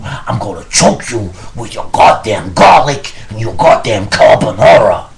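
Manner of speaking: loud, furious mobster threat; angry shout. Acted. Louis yelling threats at Vinny over carbonara, furious shout.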